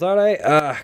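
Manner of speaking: loud dwarf send-off, tailing into indifference; dwarf character. Acted. Game master as dwarf dismissing the party, brusque. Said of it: "The dwarf goes".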